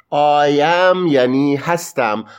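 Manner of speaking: ordinary talk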